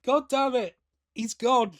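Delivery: ordinary talk, nothing marked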